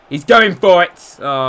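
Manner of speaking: loud, high, drawn-out match shout; excited shout. Real, not acted. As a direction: yell it as the attack breaks. Calling out live match action.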